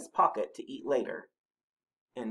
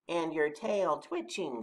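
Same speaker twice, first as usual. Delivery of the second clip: higher, slow animal voice; Rabbit's voice; 6 semitones higher, slower than usual. A narrator's reading. Rabbit joining Badger's question, slow higher voice. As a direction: chime in with another pointed detail. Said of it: "said Rabbit".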